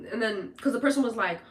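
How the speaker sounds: ordinary delivery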